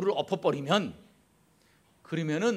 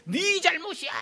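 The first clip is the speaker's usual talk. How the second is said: much higher accusing jab; blaming; 13 semitones higher, 3 dB louder than usual. Retold or imitated. Quarrelling family member blaming the other, high accusing mock snap. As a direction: fling 네 잘못이야 sharply upward at them. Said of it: "어떤 집은 ... 그러는 집이 있어요".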